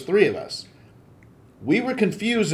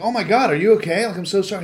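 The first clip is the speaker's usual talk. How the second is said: higher, louder flustered apology; raised-pitch "oh no, sorry" quote; alarmed apology; 7 semitones higher, 6 dB louder, faster than usual. Retold or imitated. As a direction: imitate a startled friend apologizing. Buddy apologizing after the collision.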